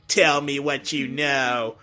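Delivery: slow, measured robot character voice; Metal Sonic. Shown as a demonstration. Voice actor demonstrating his dub line, Metal Sonic voice. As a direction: deliver it slowly, word by word. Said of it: "basically my voice when he said".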